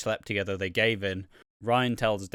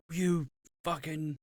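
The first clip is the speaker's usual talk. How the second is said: mock explosive anger; 4 semitones higher, slower than usual. Imagining Daniel confronting the cheaters, mock explosive anger. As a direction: play at a soap-opera outburst, held back. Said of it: "this big explosive scene".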